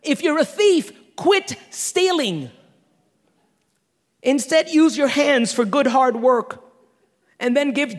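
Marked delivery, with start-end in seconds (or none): 0.0-2.5 slow, deliberate quit stealing; emphatic, slowed on quit stealing. Shown as a demonstration. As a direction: lean hard into the command. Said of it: "he doesn't say, "Get saved".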